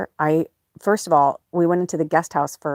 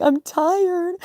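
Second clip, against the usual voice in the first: very high mock wail; retold crying exhaustion; 13 semitones higher than usual. Worn-out owner to her cat, tearful wail. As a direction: squeal it as if bawling. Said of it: "I was just, like, crying".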